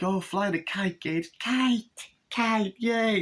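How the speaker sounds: put-on very high squeaky child voice; toddler voice. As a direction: squeal like a delighted little boy. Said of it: "And then he's like".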